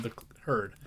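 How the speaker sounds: ordinary delivery, nothing marked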